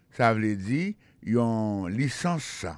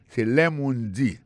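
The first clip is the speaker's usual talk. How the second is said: usual speaking voice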